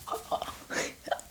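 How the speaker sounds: soft breathy laugh, well under the voice; gleeful zombie chuckle. Acted. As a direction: chuckle quietly at your victim's fate. Zombie relishes the coming transformation.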